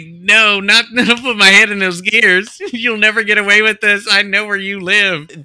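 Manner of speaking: high squeaky desperate doll. Retold or imitated. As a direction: panic in a high put-on voice. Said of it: "he's talking for the doll".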